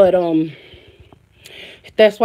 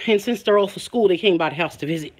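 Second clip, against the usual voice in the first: ordinary delivery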